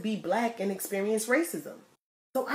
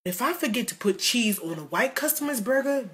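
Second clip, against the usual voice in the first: ordinary delivery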